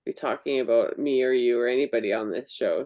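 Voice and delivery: ordinary delivery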